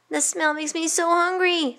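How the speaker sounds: put-on high-pitched, longing child; hungry boy. Middle boy smelling the cooking cake, high and longing. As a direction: voice a boy aching for food.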